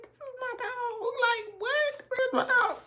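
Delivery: a frantic owner on the verge of crying; near tears. Retold or imitated. Owner searching the woods for her puppy, high and panicked. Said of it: "I was about to cry".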